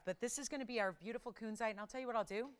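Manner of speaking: usual speaking voice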